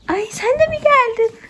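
high, slow delighted coo; pet talk. Real, not acted. Greeting another calf. (yavaş, yüksek sesle sevinçli sevgi sesi)

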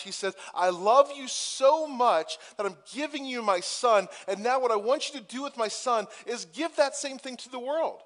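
high, loud, impassioned sermon climax; preaching at full force. Acted. Pastor urging the church to show grace, fervent preaching. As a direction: pour it out over the whole congregation.

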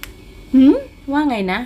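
surprised huh. A narrator's reading. He didn't catch her answer.

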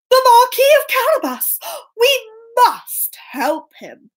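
high, gasped exclamation; alarmed king. Acted. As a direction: react urgently, slowing on the resolve. King hears the cry for help.

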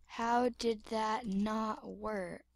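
slow, soft, puzzled question; quiet, stretched-out puzzlement; slow bafflement. Acted. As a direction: drag each word out quietly. Baffled question said slowly, then quicker.

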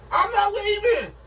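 shouted high and slow, flat refusal. Acted. Boyfriend yells at girlfriend he is not leaving, high shout. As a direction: yell it up high, you are staying.

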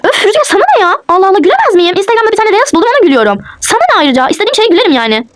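high-pitched, exasperated retort; annoyed. Acted. Wife telling husband it's none of his business, annoyed. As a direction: snap back defensively, pitch jumping up. Staged quarrel over her laughing.

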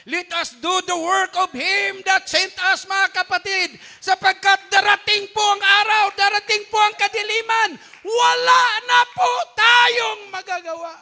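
loud high preaching cry, building to the end; full-force preaching shout. Real, not acted. Preacher warning the congregation, at full shouting force.

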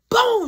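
high, loud "boom"; sudden impact noise; imitated blow. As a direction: burst in with the sound of a hit.

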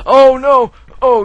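sudden loud high gasp; shocked, loud; alarmed gasp. Real, not acted. Gasp at being targeted.

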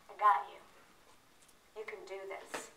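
low, soft encouragement; hushed, low supportive words; quiet reassurance. Retold or imitated. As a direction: quote it gently, dropping almost to a hush. Gently quoting supportive people to audience.

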